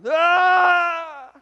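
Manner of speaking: loud, high wail; imitated long cry of anguish in the night. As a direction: let out a sustained, piercing cry. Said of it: "just sort of cry out".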